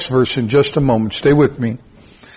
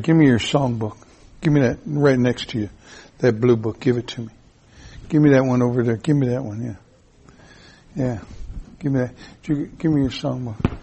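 aside to congregant; 4 semitones lower, 7 dB quieter, faster than usual. Real, not acted. Asks congregant for songbook.

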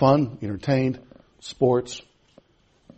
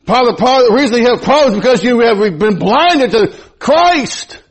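full-force preaching; 8 semitones higher, 6 dB louder than usual. Acted.

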